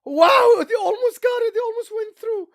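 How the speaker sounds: high, urgent burst with a loud opening word; excited near-miss call. Real, not acted. Commentator reacts as they almost went through, excited. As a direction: jump up in pitch at the near goal.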